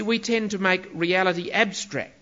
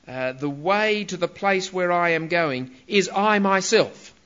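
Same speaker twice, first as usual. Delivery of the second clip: normal talking voice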